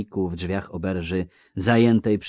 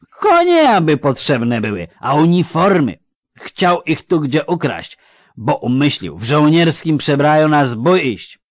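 loud, higher, slurring tipsy villager; drunk Melfi; 9 semitones higher, 13 dB louder than usual. Acted. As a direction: call out like a cheery drunk. Melfi explains. (pijany Melfi, głośno i bełkotliwie)

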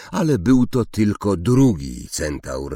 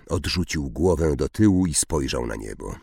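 ordinary delivery

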